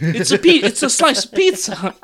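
put-on lightly done Italian accent. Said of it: "Don't use an Italian accent".

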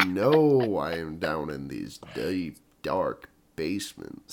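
very low, slow DJ with a slight southern lilt; deep late-night radio voice. Shown as a demonstration. Podcaster playing midnight DJ to co-host, deep and a little southern. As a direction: sink down slowly, drawing out the words. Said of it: "went a little southern there".

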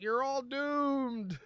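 high, very slow ominous warning; slow ominous raspy drawl. Retold or imitated. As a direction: drag it out like a creepy prophet. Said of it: "he was like".

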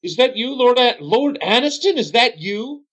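high, surprised innkeeper voice; suddenly recognize a noble; startled bartender. Acted. Bartender recognizing Lord Aniston, high and startled.

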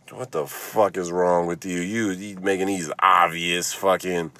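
ordinary talk

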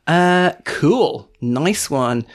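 ordinary talk, nothing marked

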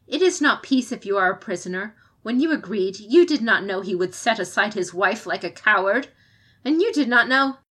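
loud, rising angry accusation; angry outburst. Acted. Komoko accusing the champion of a coward's bargain, loud and angry. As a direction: hurl it at her like an accusation.

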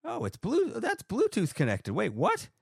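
sudden high surprise; surprised. Real, not acted. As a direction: caught off guard by the setup. Surprised at Bluetooth.

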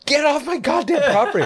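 very high laughing mock yell. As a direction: shriek it through laughter.

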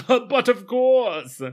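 smug nobleman. Retold or imitated. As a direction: draw it out like a smug lord. Wessex voiced in retelling.

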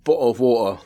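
clipped glottal British "bottle of water"; British "bottle of water" with no r at the end. Shown as a demonstration. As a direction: say "bottle of water" like a Brit, dropped t's. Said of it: "how British people say bottle of water".

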